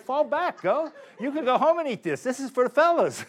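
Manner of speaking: high, laughing shoo-away; joking to the guards to back off; mock brush-off. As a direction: playfully shoo the cops off, laughing. Telling police off, joking.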